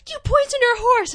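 high, loud accusing shout; mock accusing yell. Acting out the twins confronting their mother, loud mock yell. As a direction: fling the accusation hard. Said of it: "Then the other one go".

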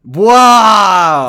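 loud, drawn-out offended wow; indignant outburst. Real, not acted. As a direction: belt it out in mock outrage. Mock outrage at joke not landing.

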